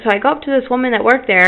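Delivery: normal talking voice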